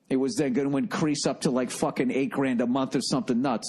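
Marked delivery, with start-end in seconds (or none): none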